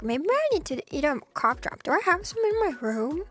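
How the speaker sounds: ordinary talk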